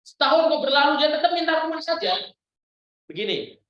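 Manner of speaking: ordinary talk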